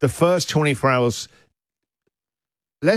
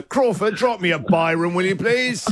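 lordly high-pitched summons; posh butler-caller voice; 5 semitones higher than usual. Pretend. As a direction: call for your butler like an aristocrat. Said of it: "He sounds like a butler from Downton Abbey".

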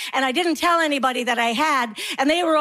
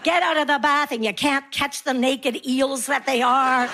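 normal talking voice

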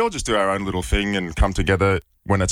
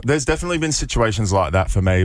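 usual speaking voice

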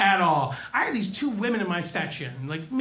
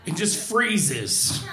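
normal talking voice

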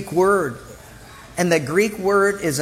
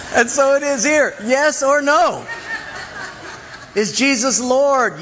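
laughing; 6 semitones higher than usual. Real, not acted. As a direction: push through the laugh into the point.

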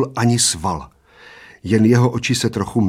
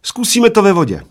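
high, loud proclamation; triumphant; 11 semitones higher, 5 dB louder than usual. A narrator's reading. Little man proposing a water rematch, triumphant. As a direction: announce the new challenge proudly. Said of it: "maličký vítězoslavně".

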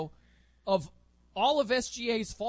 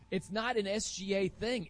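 normal talking voice

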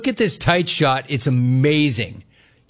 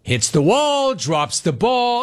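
excited; 8 semitones higher, slower than usual. Real, not acted. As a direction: pitch jumps high on "wall". Reliving the blown catch.

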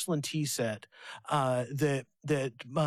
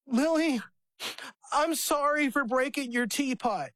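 a hint of a small boy's sincere apology, higher; lightly voiced little boy; 7 semitones higher than usual. Father retelling his son's apology to his sister, a light child voice. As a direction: lightly mimic a four-year-old saying sorry. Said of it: "and he says".

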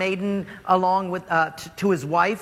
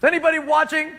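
embarrassed; 8 semitones higher, 4 dB louder, faster than usual. Acted. Naaman embarrassed.

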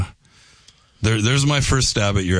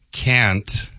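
"can't" with a North Dakota flat a; drawn-out North Dakota "can't"; North Dakota accent. Shown as a demonstration. Host on air showing his home North Dakota accent. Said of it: "let me do my best North Dakota accent".

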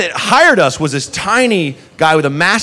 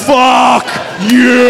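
higher, louder defiant shout; defiant yell; 6 semitones higher, 4 dB louder than usual. Retold or imitated. Boss shouts at the food runners, defiant yell. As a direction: spit it at each of them. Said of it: "looked at us each individually like".

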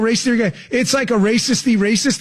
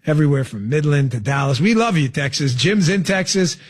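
ordinary delivery

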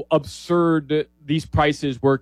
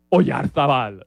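Spanish accent; slower than usual. Real, not acted. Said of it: "I keep saying it that way".

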